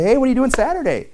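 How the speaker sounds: high, sing-song breezy ask; high innocent mock invitation; fake-casual ask. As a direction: bait the friend with a cheery setup. Mock asking friend for favor.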